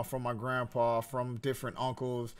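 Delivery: usual speaking voice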